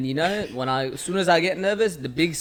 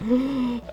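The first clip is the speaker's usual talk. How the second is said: high, sharp gasp of realization; acted gasp of dismay; horrified gasp; 10 semitones higher than usual. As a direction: suck in air as it dawns on you. Re-enacts freezing on stage in realization.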